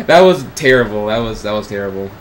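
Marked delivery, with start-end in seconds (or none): none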